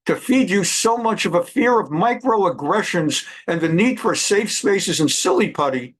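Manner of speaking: usual speaking voice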